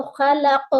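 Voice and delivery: خلق ending in a bounced, heavy ق; قاف پر قلقلہ; qalqala on qaf. Shown as a demonstration. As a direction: echo the final qaf. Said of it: "آخر میں قاف پر قلقلہ ہوگا".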